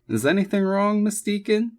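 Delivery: high-pitched courteous question; polite. A narrator's reading. Man asking the realtor what startled her, polite. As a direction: ask it politely, voice lifted high. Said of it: "he asked politely".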